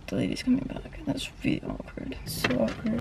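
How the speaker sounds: real hushed, embarrassed aside. Real, not acted. Vlogger to camera as the lady comes back, hushed. Lady returns while filming outside.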